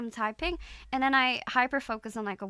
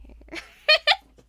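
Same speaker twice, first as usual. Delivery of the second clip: high giggle burst; 12 dB louder than usual. Real, not acted. Laughs after compliment.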